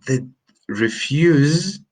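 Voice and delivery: "refuse" said REF-use, slowly, with a rising tune; noun refuse. Shown as a demonstration. Teacher to Spanish learners, slow drawn-out noun "refuse".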